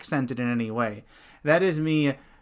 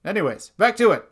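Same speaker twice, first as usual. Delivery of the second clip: mock breezy cover-up; 7 semitones higher, 7 dB louder, faster than usual. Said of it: "You say".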